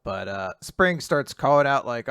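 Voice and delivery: ordinary talk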